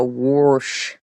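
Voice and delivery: Pittsburgh "warsh" with an added R; imitated Pittsburgh pronunciation; Pittsburgh accent. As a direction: say it like a Pittsburgh local. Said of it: "'cause they go".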